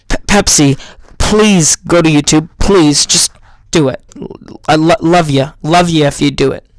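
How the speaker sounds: loud, low begging; mock pleading. Begging Pepsi to bring its ads back, loud and pleading. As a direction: plead loudly, half joking.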